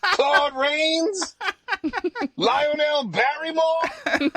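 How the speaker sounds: very high, hammed-up challenge; mock-indignant put-on character voice; Mother Nature. Mother Nature sizes up the head of marketing, high and mock-indignant. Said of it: "She looks at the head of marketing".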